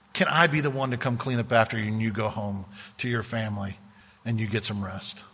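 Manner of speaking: a tender offer to help; gentle offer. Pretend. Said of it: "we have to do it in such a way that we're gentle".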